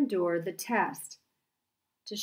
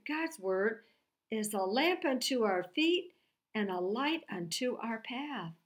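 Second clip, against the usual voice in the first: normal talking voice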